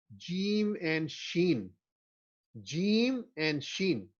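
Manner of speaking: slow, careful جیم and شین; clear articulation. Shown as a demonstration. Teacher modelling letters for students, slow and clear. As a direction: pronounce جیم and شین slowly and distinctly. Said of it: "clearly آپ نے ادا کرنا ہے".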